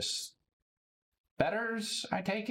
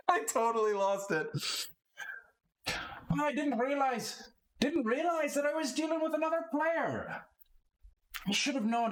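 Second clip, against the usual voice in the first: much higher, sheepish laugh; flustered fan; 14 semitones higher than usual. Acted. Lot admitting he missed the star player, high and flustered. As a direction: gush with embarrassment.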